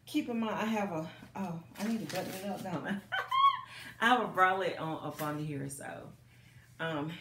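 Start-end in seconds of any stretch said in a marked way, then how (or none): 3.0-3.9 very high squealing laugh; a sudden high giggle; squeal of laughter. Real, not acted. Laughs at forgetting to button up.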